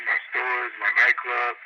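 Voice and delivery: ordinary talk, nothing marked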